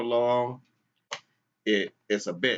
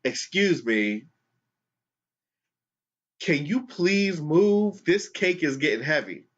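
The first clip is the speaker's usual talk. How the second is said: slow, strained politeness rising higher on please move; mock-polite plea from a woman holding a cake; a hint of a put-upon stranger; polite annoyed lady; 5 semitones higher than usual. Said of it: "So the lady goes".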